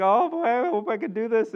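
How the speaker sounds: put-on a scared kid behind the wheel; nervous child driver. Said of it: "they're like".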